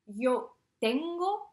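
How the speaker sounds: tengo drawn out slowly with the g brought out; slow demo. Shown as a demonstration. Teacher showing the class the irregular G in tengo, slow and clear. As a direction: spell out tengo slowly for students. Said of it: "vous voyez la petite irru-, irrégularité".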